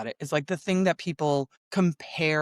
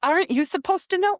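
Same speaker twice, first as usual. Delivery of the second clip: much higher, louder disbelief; incredulous retort; 14 semitones higher, 5 dB louder than usual. Retold or imitated. Retelling her reply to the doctors, higher and louder disbelief. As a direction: throw the question back, higher and louder.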